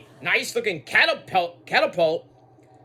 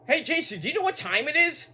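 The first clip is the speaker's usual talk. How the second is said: mock taunt, higher; 8 semitones higher, faster than usual. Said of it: "Stephanie's like".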